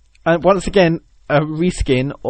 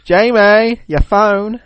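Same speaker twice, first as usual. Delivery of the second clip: higher, louder singsong camp call; camp ringtone voice; 5 semitones higher, 4 dB louder, much slower than usual. Retold or imitated. Phone alerting its owner, camp ringtone voice. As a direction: imitate the camp voice, calling out. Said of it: "I want that to be my, like, ringtone".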